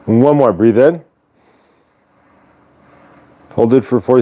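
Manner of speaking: calm counted breathing cues; guided breathing. Acted. Leading viewers through a breathing exercise calmly.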